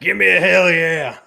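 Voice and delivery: hype call. Acted. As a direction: whip up the crowd. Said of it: "Stone Cold impression".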